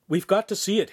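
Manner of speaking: insistent plea. A narrator's reading. Handsome pressing the bishop, insistent demand. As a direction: insist on seeing it. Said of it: "Handsome repeated".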